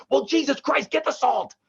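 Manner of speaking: high, exasperated sister snapping back. Retold or imitated. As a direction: toss it back at mom, fed up. Sister snaps back at mom.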